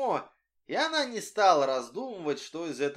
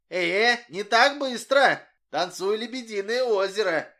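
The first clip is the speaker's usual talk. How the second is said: teasing; 6 semitones higher, 7 dB louder than usual. A narrator's reading. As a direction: make her dance for it. Boy teasing girl.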